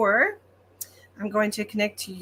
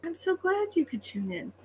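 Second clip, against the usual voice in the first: softer excited repeat; 8 semitones higher, 6 dB quieter than usual. Real, not acted. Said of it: "I'm so excited".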